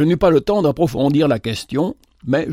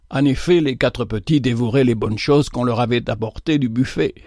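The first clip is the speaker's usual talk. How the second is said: ordinary delivery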